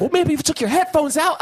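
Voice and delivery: high mock retort; retold screaming. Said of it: "so many screaming".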